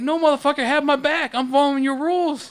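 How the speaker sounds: high, loud exasperated outburst; angry retort. Retold or imitated. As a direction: snap back, fed up with the rules. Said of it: "I'm like".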